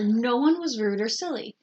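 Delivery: plain reading voice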